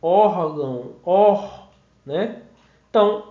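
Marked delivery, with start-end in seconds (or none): 0.0-1.7 "Órgão" with weight on ÓR, then "or" alone; stressed first syllable. Shown as a demonstration. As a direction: lean on the "Ór" of "Órgão", then isolate "or". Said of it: "Demorei mais tempo".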